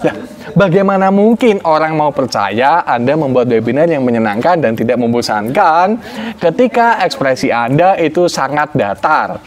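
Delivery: normal talking voice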